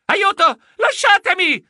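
loud, high cry for help in the dark; frightened shout. A narrator's reading. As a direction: yell it, grabbed in the dark. (grido spaventato, forte e acuto)

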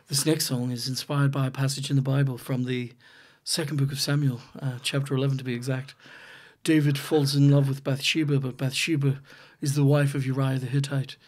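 low, quiet, measured stage introduction. Retold or imitated. Comedian imitating a singer's solemn song introduction, low and hushed. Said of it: "Do you know how he introduces this? He has a wee spiel".

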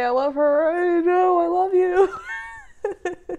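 high, rising cry into a laugh; choked-up affection breaking into laughter; real emotional gushing. Real, not acted. Gushing tearful message to her mom.

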